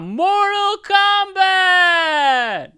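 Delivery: huge rising shout on Kombat; Mortal Kombat announcer. Pretend. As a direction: bellow the title like the game's announcer. Announcing the topic like the game.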